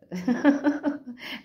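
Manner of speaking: a loud amused laugh; burst of laughter. Real, not acted. Laughing after mocking the arrogant runaway, loud laugh. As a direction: laugh out at the joke.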